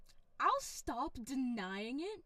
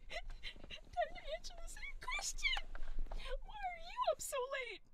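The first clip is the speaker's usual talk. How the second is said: squeaky voice. Pretend. Squeaky voice answering chat.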